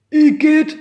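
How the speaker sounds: put-on high, loud grossed-out kid; disgusted. A narrator's reading. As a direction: recoil from the kiss. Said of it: "sagte Pekka".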